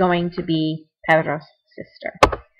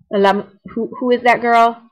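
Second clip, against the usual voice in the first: normal talking voice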